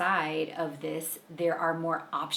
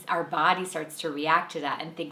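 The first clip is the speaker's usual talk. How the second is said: ordinary talk, nothing marked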